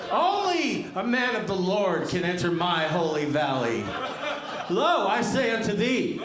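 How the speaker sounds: high, loud, booming biblical rant; fire-and-brimstone preacher. Retold or imitated. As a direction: thunder like a mad zealot. Mock biblical zealot reading profile.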